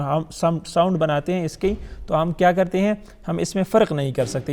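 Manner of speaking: ordinary talk, nothing marked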